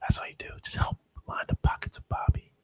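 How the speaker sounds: ASMR whisper. Pretend. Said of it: "I'm just gonna give you my A S M R voice".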